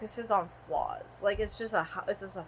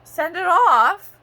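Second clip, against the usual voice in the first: mock postman; 7 semitones higher, 5 dB louder, much slower than usual. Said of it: "He's like".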